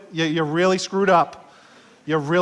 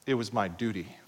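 low, soft, flat-duty reply, much quieter; dutiful husband; 8 semitones lower, 8 dB quieter than usual. Retold or imitated. Husband hands his wife roses, answers without feeling. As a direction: mumble it like a chore you owe. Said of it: "you better not say".

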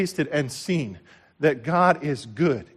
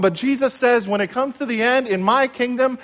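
ordinary talk, nothing marked

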